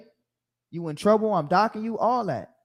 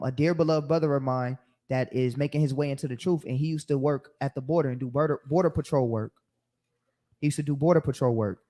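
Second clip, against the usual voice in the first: ordinary talk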